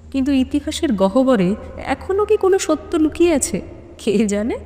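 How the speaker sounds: ordinary talk, nothing marked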